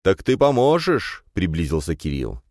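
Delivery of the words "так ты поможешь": high, loud, stretched question; eager pleading. A narrator's reading. Friend drawing closer for an answer, eager pleading. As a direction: lean in and beg hopefully.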